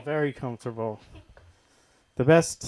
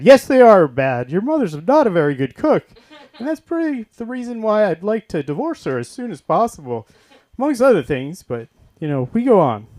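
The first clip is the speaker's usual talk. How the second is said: loud, higher live-announcer voice; broadcaster dad at dinner; 6 semitones higher, 14 dB louder than usual. Retold or imitated. Father broadcasting the family dinner, loud announcer voice. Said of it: "And then he'd be like".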